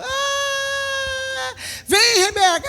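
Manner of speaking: put-on high, sing-song call to a baby; high cooing call to a little girl; coaxing baby. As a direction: coax a frozen toddler to step forward. Mother calling baby again, higher.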